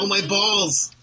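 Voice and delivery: pained yelp at a groin hit; just hit in the groin. Acted. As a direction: cry out like you just got kicked. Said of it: "Ow, my balls is a staple of that film".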